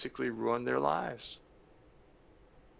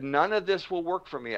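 mildly angry, higher protest; retold anger; 5 semitones higher than usual. Angry addict rejecting the counselor's program, mildly heated. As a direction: push back with mock exasperation, pitched up. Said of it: "I am really angry".

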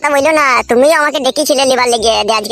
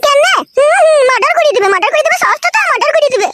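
high, sneering mimicry of the threat; a sneering echo of the uncle's threat; mocking; 12 semitones higher than usual. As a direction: throw his words back at him scornfully.

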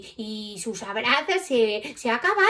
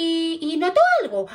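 plain reading voice

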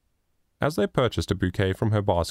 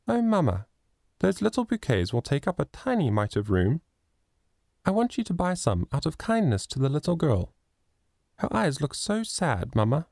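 put-on high, eager childlike voice; a sweet little girl coaxing her mother; little girl Lily; 6 semitones higher than usual. A narrator's reading. Little Lily pleading with mamma to buy the flowers, high and childlike.

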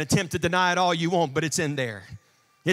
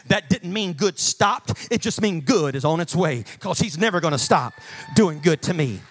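ordinary talk, nothing marked

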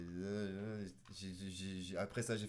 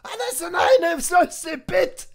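loud high mock-German shout; German accent; 19 dB louder, slower than usual. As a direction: bark it like a laughing German. Mock German outburst, laughing. (accent allemand crié en riant)

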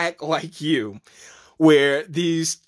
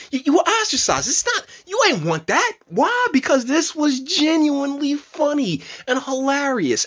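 excited; 10 semitones higher than usual. Real, not acted. Excited rave about the show.

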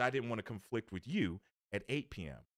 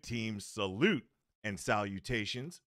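usual speaking voice

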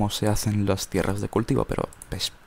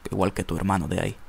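ordinary delivery

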